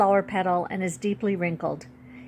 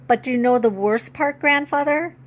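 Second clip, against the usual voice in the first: higher and louder, close to tears; pleading, voice climbing up; girl's voice pitching up as she starts to cry; voice rising high; 5 semitones higher, 5 dB louder than usual. Acted. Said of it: "how high my voice has become".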